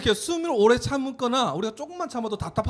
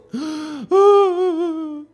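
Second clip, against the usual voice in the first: shocked gasp; 9 semitones higher, 5 dB louder than usual. Acted. Brother died in story.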